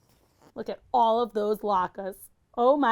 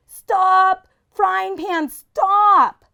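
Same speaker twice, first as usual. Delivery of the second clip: much higher, much louder yell; frantic shout; 11 semitones higher, 10 dB louder, much slower than usual. Acted. Chaim yelling at the runaway pan, frantic shout. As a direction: scream at the pan to halt. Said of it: "Chaim cried".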